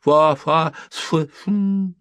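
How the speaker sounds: loud, high bursts of garbled syllables; menacing half-awake babble; threatening sleep-shouting. A narrator's reading. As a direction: shout nonsense in your sleep, then fizzle out. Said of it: "угрожающе вскрикнул спящий".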